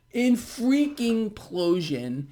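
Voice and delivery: In freaking implosion said slowly, freaking and implosion punched; slow, emphatic In freaking implosion; stressed phrase. Real, not acted. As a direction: drag out In freaking implosion, rising. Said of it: "I can't stress that word enough".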